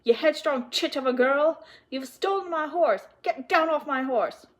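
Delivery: loud, high, furious scolding; enraged horse owner's tirade; yelling. A narrator's reading. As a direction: roar accusations at the thief. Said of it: "yelled Mister Nye".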